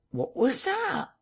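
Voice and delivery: very high, drawn-out question; puzzled query. A narrator's reading. Eavesdropper at the door asks his companion, high puzzled query. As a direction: ask it slowly in a squeak of curiosity.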